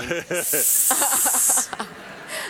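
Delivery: long hiss as a monologue; drawn-out mock snake hiss.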